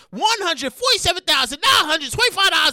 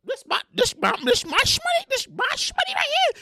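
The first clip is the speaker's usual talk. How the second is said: disbelieving account holder; 6 semitones higher, 6 dB quieter, faster than usual. Retold or imitated. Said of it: "you be like".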